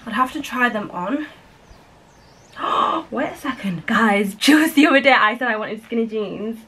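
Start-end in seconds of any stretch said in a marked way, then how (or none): 2.6-3.1 surprised gasp. Real, not acted. Finds jeans in bag.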